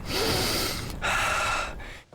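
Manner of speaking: long high unvoiced swoon sigh; blissful sigh. Swooning over boy smell for the green text, a long high sigh. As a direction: sigh like you smell heaven. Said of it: "reading this".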